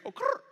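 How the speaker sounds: a quick sassy little growl before she talks; playful imitated growl. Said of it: "the first thing I hear is".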